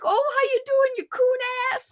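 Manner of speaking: put-on high-pitched caricature of a Cajun accent; mock Cajun accent. Podcaster mimicking how people say Cajun people sound, mock Cajun accent. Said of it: "how people say Cajun people sound".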